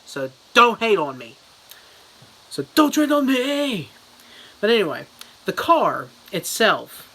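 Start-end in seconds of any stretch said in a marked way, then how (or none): 2.5-3.9 mock high squeak. Jokey mock defiance.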